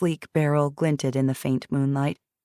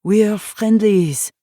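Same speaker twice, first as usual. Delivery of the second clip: put-on French accent; 5 semitones higher, 6 dB louder, much slower than usual. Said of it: "said a familiar voice in a French accent".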